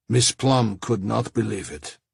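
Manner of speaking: plain reading voice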